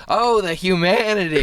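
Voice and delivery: high-pitched mock anguished cry; Hindenburg lament. Comic quoting the Hindenburg blowing up, mock anguish. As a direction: wail it like a stricken eyewitness, in jest. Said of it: "And also the, the Hindenburg blowing up".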